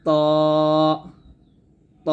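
ত said full and heavy, not তোয়া; a thick emphatic ত; heavy Arabic ta. Shown as a demonstration. Teacher correcting তোয়া to ত. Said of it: "এটাকে তোয়া বলবেন না".

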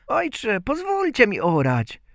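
put-on high-pitched pleading child; Bździelik. A narrator's reading. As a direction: beg father in a tiny high voice. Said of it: "Bździelik tymczasem zaczął prosić ojca". (proszący, wysoki głosik malca)

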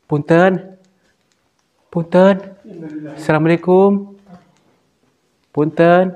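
loud, high polite hail; calling out. Real, not acted. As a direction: call into the dark to whoever is there. Calling out to the unseen figure.

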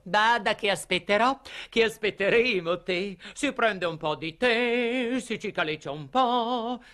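high-pitched society lady's farewell; lady's parting call. Acted. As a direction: call out a sociable goodbye, high and stretched. Lady's playful farewell, quoted.

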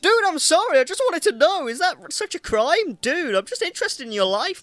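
high-pitched, put-upon whine of complaint; mock aggrieved protest. Player defending his questions to a sarcastic merchant, mock aggrieved. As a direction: protest your innocence, starting very high and loud.

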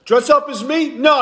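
usual speaking voice

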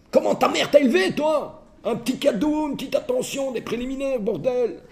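shrill-high furious outburst; furious wife. Acted. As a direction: let her explode at him. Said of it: "elle n'en peut plus".

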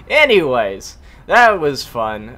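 loud, drawn-out excited wrap-up. Real, not acted. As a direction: burst out happily, slowly. Excited after a wild kill.

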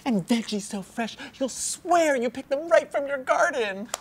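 high, loud, hammed-up ad voice; mock the cheesy sales line, pitch way up; mocking the script. Trainer parodying the old scripted pitch, high and loud. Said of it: "it feels like freedom after months of".

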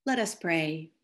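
normal talking voice

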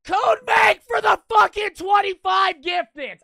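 high, slow full-voice scream. Real, not acted. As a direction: scream the thanks out. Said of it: "I gotta stop screaming".

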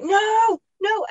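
high, loud panicked no, no; mock alarmed mum shouting no; mum's sharp no. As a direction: cry out no like a startled mum. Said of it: "my mum would be like".

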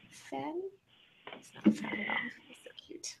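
soft unvoiced whisper to the little cat; whisper to pet. Real, not acted. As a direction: whisper sweetly so the pet stays calm.

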